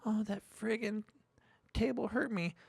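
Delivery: imitating a kid whining over a stubbed toe; whiny child. Father mimicking his son complaining about the table, mock whine.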